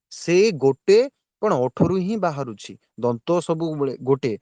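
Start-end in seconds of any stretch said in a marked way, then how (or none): none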